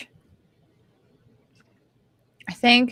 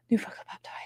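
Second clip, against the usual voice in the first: whispering; 8 dB quieter, faster than usual. Real, not acted. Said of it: "whispered aside".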